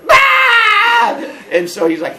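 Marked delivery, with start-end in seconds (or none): 0.1-1.1 long, loud high shriek; comic child's scream at full volume; scream in face. Retold or imitated. Caught boy answering the man again, long high scream. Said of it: "my response was to scream in his face".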